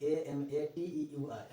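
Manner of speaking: slow, letter by letter: A M A T E U आर; dictating the spelling of amateur. Shown as a demonstration. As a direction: spell A M A T E U आर with a pause after each letter. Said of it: "याद रखिएगा spelling".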